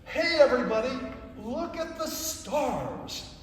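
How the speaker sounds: a bright shout that slows to a hush; joyful call. Shown as a demonstration. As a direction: call to the whole world with joy, then slow on "look at the stars". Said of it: "this is for the whole world".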